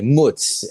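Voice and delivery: "mutz" as the clipped Italian-American form of mozzarella; Italian-American pronunciation. Retold or imitated. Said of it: "how they pronounce fucking, like, mozzarella".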